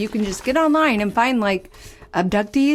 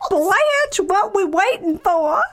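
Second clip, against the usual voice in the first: high-pitched, drawn-out Southern belle; Southern belle accent; 8 semitones higher, slower than usual. Acted. Improvised witness eager to tell Blanche, Southern accent. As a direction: ham up the impatient Southern belle. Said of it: "our Southern Belle accents".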